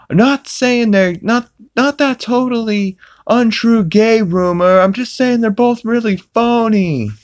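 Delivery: high cartoon dad voice; high goofy Peter impression. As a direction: confess to the worshippers, goofy and rambling. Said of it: "Peter's like".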